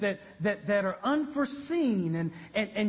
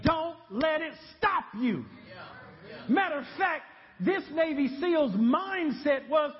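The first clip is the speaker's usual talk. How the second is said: higher shouted preaching; full-force preaching; 5 semitones higher than usual. Acted. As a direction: drive it home with a shout.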